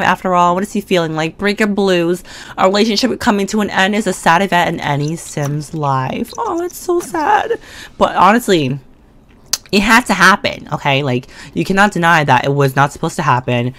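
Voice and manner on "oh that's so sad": high, slow mock-pitying voice; mock sad. A streamer reacting to her Sim's breakup, exaggerated mock pity. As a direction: coo over it with fake sympathy. Sim gets breakup blues.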